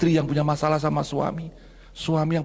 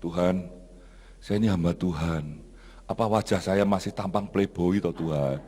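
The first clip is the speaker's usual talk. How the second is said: low, understated lament; retold tearful prayer; 7 semitones lower than usual. Pastor praying after feeling insulted, mildly tearful low lament. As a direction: plead with God in a lowered, wounded voice. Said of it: "Terus saya nangis".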